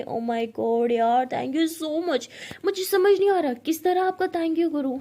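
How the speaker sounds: loud, mocking fake gratitude; sarcastic. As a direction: drip with sarcasm. Pari sarcastically thanks Ishani. (ताना मारते हुए)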